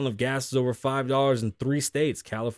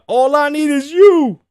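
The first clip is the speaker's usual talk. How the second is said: much higher, much louder, drawn out; mock yell; 14 semitones higher, 13 dB louder, slower than usual. Man yells at Mike Tyson, high drawn-out mock yell. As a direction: wail it like an obsessed fan.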